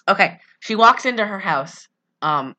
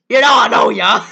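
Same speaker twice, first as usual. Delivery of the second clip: Eddie Murphy; 3 semitones higher, 9 dB louder than usual. Retold or imitated. Host mimicking the co-host's joke, loud Eddie Murphy impression. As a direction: holler it with cocky comic punch. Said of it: "he does sound like Eddie Murphy".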